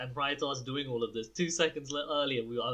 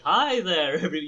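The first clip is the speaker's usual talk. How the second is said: Doctor Nick impression; 3 semitones higher, 8 dB louder than usual. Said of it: "Doctor Nick from Simpsons".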